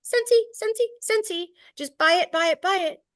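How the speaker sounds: high, chanting mock sales pitch; sing-song mock hard sell; mock pushy seller. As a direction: parody a desperate spammy seller. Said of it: "if you're sharing".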